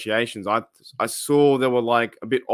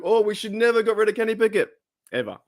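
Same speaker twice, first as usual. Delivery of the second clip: higher voice of a fickle fan, gushing; mock fan gushing; 8 semitones higher, faster than usual. Host imitating fickle Steelers fans, higher and gushing. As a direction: gush like a fan who has suddenly changed his mind.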